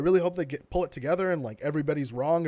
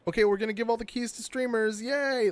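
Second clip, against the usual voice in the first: mock cheery corporate voice; 7 semitones higher than usual. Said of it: "then they're like".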